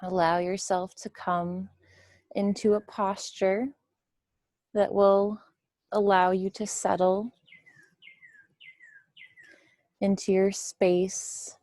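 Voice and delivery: unhurried meditation guide, long pauses between phrases; guided meditation. Real, not acted. Teacher settling listeners into a comfortable position, guided meditation.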